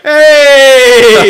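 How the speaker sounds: high, loud cheery Hey; phone Hey shout. Retold or imitated. Mates answering the phone with a loud high Hey. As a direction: yell Hey like answering a mate's call. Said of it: "we're always like".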